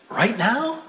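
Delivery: "Right now" high and rising; high-pitched childish check on "Right now"; startled question. Acted. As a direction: ask "Right now" as a surprised little kid.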